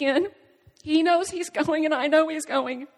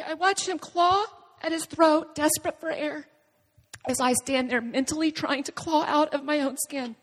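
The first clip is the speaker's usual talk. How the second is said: normal talking voice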